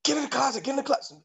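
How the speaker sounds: high-pitched panicked woman, hushed urgency; high, urgent mock plea. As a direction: imitate her frantic order to hide. Woman hurriedly hides lover.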